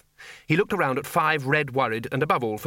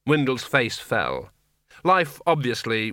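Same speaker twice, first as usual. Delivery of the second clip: usual speaking voice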